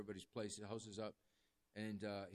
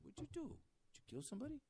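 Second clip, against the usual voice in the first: high, startled disbelief, but softer; shocked question; 14 semitones higher, 6 dB quieter, much faster than usual. Retold or imitated. Chaplain shocked by eighty years.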